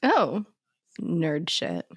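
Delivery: ordinary talk, nothing marked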